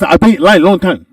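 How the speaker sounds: laughing imitation of a Japanese customer's English; mock Japanese accent. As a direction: complain in a put-on Japanese accent. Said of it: "Japanese, just".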